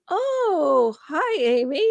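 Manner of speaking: imitating a caught-off-guard father; lightly voiced startled dad greeting; surprised dad. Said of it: "And he's like".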